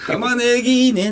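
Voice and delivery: ordinary delivery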